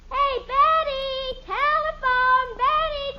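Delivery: calling out. Real, not acted. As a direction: stretch it out, calling across the house. Calling her sister to the phone.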